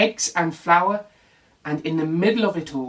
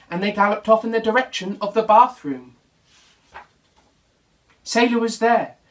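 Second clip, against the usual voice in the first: plain reading voice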